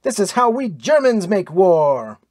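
high, exultant boast; triumphant, pitched well up; triumphant crowing. A narrator's reading. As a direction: gloat over the victory aloud. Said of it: "cried Victor Hasting triumphantly".

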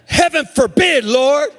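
high-pitched emphatic outcry; loud exclamation. Acted. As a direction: exclaim it as Peter's protest. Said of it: "the reason I said it loud like that".

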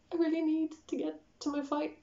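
high, small crying voice; mock tearful plea. Crying traveller begging airport workers for help, mock tearful. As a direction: imitate pleading through tears.

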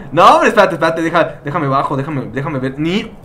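ordinary talk

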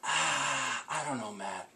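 sigh, then very soft, low reluctance; hesitant mother. Retold or imitated. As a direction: imitate a subdued mom confiding mild disapproval. Mother's hesitant, reserved reply imitated.

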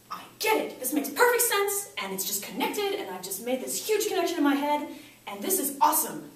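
high, thrilled realization; excited breakthrough. Acted. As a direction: gush as it all clicks. Acts out a student's breakthrough.

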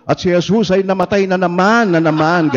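put-on shouting the line a second time to the hidden altar boy; mock shout. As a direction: call it out again, half-amused. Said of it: "sumigaw ulit ako".

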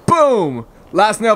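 loud triumphant shout. Real, not acted. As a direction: celebrate the last nail. Last nail goes in.

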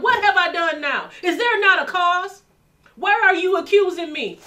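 loud, high, forceful challenge; David demanding an answer, shouting; warrior's shout. Retold or imitated. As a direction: thunder it like a warrior. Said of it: "No, David was a warrior".

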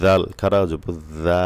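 slow drill, tongue tip out for "জাল", long final "জা"; thin tongue-tip sound. Shown as a demonstration. Teacher showing the letter, slow, thin tongue-tip "জা" held long. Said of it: "জাল পাতলা হবে জিব্বার আগা দিয়ে".

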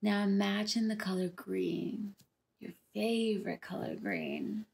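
slow, calm meditation delivery, unhurried pauses between phrases; guided meditation. Acted. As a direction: lead them gently through a visualization, drawing out key words. Leads the rainbow meditation.

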